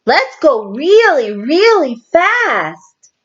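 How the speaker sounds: high, stretched out, slow on fast; excited child voice; eager Rebecca. A narrator's reading. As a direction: drag out the really fast.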